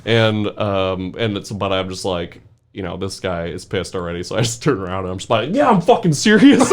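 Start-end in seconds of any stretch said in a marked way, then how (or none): 5.5-6.7 higher, louder defiant laughing taunt; cocky taunt. Retold or imitated. The kid taunting the angry cop, cocky laughing defiance. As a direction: needle the cop back.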